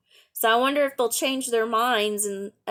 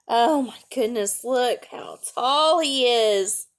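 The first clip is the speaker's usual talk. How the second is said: ordinary delivery, nothing marked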